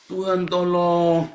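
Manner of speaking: loud, high, drawn-out plea; mock cry for help. Preacher imitating a desperate believer, crying out long and loud. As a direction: stretch it into a hammed-up wail to heaven.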